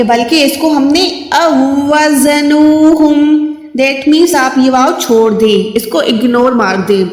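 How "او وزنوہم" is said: او وزنوہم slowly, with the واو doubled; correct reading. Shown as a demonstration. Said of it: "اس کو او وزن نہیں پڑھیں گے".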